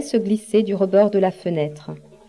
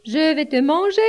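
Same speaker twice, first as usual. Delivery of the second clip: high-pitched rabbit calling out; storybook rabbit, high voice without real shouting; crying-out rabbit; 8 semitones higher than usual. A narrator's reading. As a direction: call out high as a hungry rabbit. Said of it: "crie le lapin".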